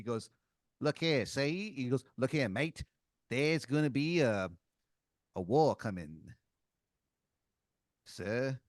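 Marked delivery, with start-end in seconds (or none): 0.8-1.7 put-on lightly done Australian accent; rough attempt at an Australian accent. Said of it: "was that an Australian accent". 2.2-8.6 put-on halting Australian accent with pauses; rough attempt at an Australian accent. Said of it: "was that an Australian accent".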